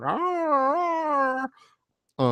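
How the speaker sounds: high, far-off mock "ah, ah" moans; imitating wordless pleading noises. Imitating the woman begging at the counter with high wordless noises. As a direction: mimic a woman grunting for money. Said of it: "making these noises with her hands".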